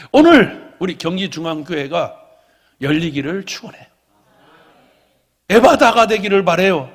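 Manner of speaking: loud, rising, fervent sermon climax; full-force preaching. Acted. Pastor proclaims a blessing over the church, loud and fervent. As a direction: lift the room with a loud blessing.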